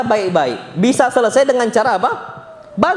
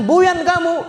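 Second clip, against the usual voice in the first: higher, scolding parent; mock scolding; 9 semitones higher, slower than usual. Preacher acts a parent scolding a child, mock angry.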